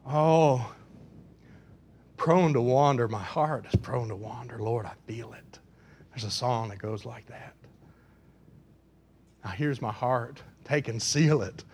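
low, hushed confession; hymn recited softly. Acted. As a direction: speak the hymn as a private prayer.

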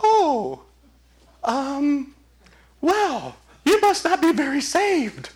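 high, hesitant mock church voice; disapproving church folk. Church members doubting a new convert, high-pitched and hesitant. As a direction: mimic squeamish churchgoers, high and halting. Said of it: "And they're like".

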